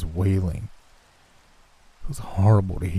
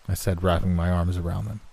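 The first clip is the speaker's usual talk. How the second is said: usual speaking voice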